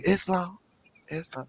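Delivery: imitating a near-breathless soft "Islam", fading away; mock soft-spoken greeting. Mimicking the soft-spoken Moors greeting: fading soft murmur. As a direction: mimic how they talk real soft, dropping to almost nothing. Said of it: "'Cause they talk real soft".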